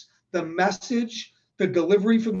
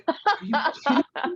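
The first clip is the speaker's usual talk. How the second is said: fast, much higher mock outrage; exasperated disbelief; 8 semitones higher, much faster than usual. Husband catching wife texting at conference, fast disbelief. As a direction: blurt it out, can't believe she's texting. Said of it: "And I'm like".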